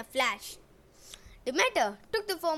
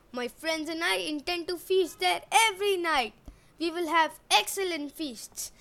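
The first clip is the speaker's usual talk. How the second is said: boastful prince; 3 semitones higher than usual. Acted. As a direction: show off to the little girl. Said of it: "Eric said".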